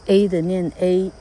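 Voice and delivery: A said as its own letter name, "ay"; vowel letter name. Shown as a demonstration. Teacher showing how the vowel reappears, A said as "ay". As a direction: sound out A as a full long "ay". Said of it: "这时候它要元音重现".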